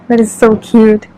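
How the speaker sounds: ordinary delivery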